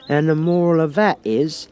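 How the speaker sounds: drawn-out sermon on love; moralising Duchess. A narrator's reading.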